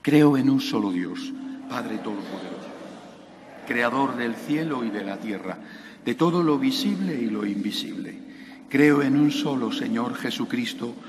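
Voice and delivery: steady liturgical recitation; recited Creed. Real, not acted. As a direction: recite the Creed evenly with the congregation.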